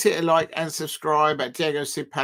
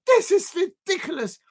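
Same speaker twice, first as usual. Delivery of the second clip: outraged shout; 11 semitones higher than usual. Real, not acted. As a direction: shout it, fully fed up. Outraged repeat, then calmer.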